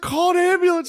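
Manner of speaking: high, loud urgent plea. Retold or imitated. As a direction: call for help in a high panic. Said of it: "She was like".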